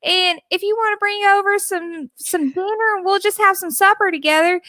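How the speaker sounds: playful impression of a Southern mother; mom's thick Southern accent. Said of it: "then he talked to my mom and she was like".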